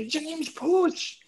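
a hint of a coach shouting at James to push; mock scream. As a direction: call out his name high like shouting on court. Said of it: "I'm gonna scream".

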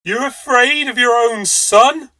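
high, loud, slow reproach; indignant cry. Acted. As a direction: cry out in scorn. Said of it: "she cried".

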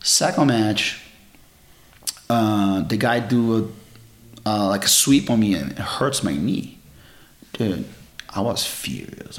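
usual speaking voice

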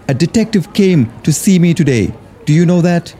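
put-on fretful, worried young man; nervous Junus voice. A narrator's reading. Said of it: "Junus muttered".

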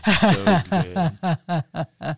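high, slow, laughing through the words. Retold or imitated. Telling the guest about a customer's praise, laughing.

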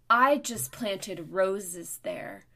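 loud, slow indignant complaint; mock outraged scolding; retold angry yelling. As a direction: imitate an angry stranger, drawing it out loudly. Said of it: "She was screaming at me".

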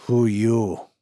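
put-on gruff Eastern European accent, clipped words. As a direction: bark it like a suspicious Eastern European miner. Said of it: "with an Eastern European accent".

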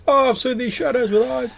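squeaky imitation of Leopold's fright; mocking Leopold. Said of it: "you hear, like, Leopold going".

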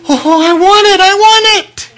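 high, tearful pleading; weeping plea. Retold or imitated. Teen boy weeping to the pastor after hearing the gospel, high tearful plea. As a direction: cry out with longing through tears. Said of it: "And I'm crying and I'm weeping".

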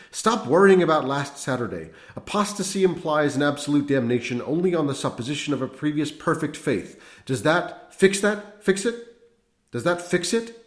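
high, loud, feverish; agitated priest. A narrator's reading. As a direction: press the point feverishly.

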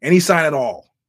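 loud hopeful ask after a pause; loud hopeful plea; deadpan plea. Retold or imitated. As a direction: shout it up to the ceiling, hoping. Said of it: "he pauses and goes".

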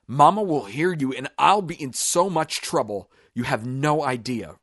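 high, loud, frantic warning; panicked pleading. Acted. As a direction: plead in a panic about getting caught. Panicking as victim screams for help.

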